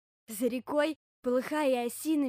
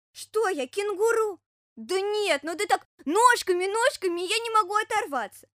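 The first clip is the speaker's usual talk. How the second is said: put-on high, loud childlike bear-cub voice; excitable bear cub; 9 semitones higher, 9 dB louder than usual. Bear cub finding the running hedgehog funny, high and excited. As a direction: protest in a high little cub's voice.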